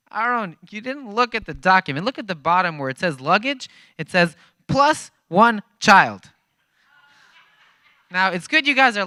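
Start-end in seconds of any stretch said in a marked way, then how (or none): 0.1-6.3 laughing guide pointing at the paper; amused Jacob. Retold or imitated. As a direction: talk down to him like it's obvious. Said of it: "And Jacob's like".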